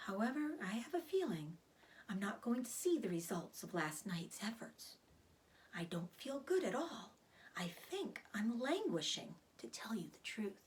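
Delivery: hushed, sad whisper; sad, weak voice. Acted. Charlotte telling Wilbur she won't see her children, sad whisper. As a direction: let the sadness drain the voice.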